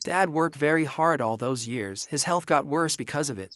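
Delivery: ordinary delivery, nothing marked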